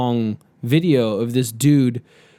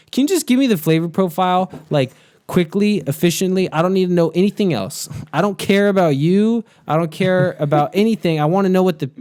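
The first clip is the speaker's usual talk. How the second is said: raised pitch, fed-up insistence; impatient demand; 6 semitones higher than usual. Acted. Viewer wanting only the flavor profile, impatient. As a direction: press the point like a fed-up customer.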